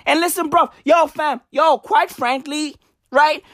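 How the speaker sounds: ordinary talk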